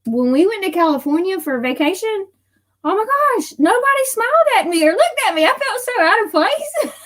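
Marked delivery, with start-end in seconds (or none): 2.8-6.9 high-pitched, laughing disbelief; exclaiming in amused dismay; animated dismay. Real, not acted. Dismayed retelling of unfriendly vacation.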